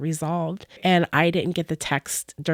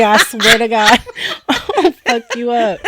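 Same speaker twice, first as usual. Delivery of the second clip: louder, laughing fake menace; laughing mock threat; 5 semitones higher, 8 dB louder, slower than usual. Real, not acted. Host jokingly threatening over Burger King, laughing.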